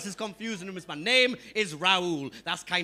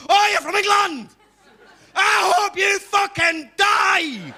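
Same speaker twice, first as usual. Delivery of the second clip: put-on high, loud Glasgow-accented yell; hammed-up furious Glaswegian shouting; unfriendly Glaswegian; 10 semitones higher, 8 dB louder, slower than usual. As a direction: roar it in a hostile Glasgow accent, drawing out the curse. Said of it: "bit less friendly".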